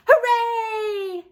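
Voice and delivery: high, loud cheer; a joyful whoop from the whole class; shouted. Acted. Kids cheering the teacher's picnic news, high and loud. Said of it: "everyone shouted".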